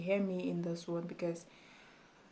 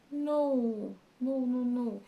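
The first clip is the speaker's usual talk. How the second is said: emphatic higher no, no, no; scolding the lazy poster, a firm no; 5 semitones higher, 3 dB louder, much slower than usual. Real, not acted. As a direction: shut the idea down firmly. Rejecting inconsistent posting.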